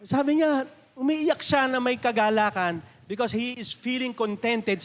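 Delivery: ordinary talk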